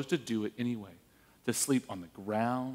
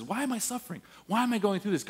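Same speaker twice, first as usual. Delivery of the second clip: higher-pitched retold plea; a touch of pleading, pitched up; anguished questioning; 5 semitones higher, faster than usual. Job asks God about his suffering, mildly anguished plea.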